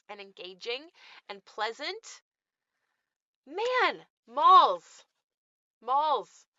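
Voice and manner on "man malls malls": higher and louder, sighing the word malls; nostalgic exclamation. Real, not acted. Vlogger moans about malls, louder and higher. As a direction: call out malls with longing.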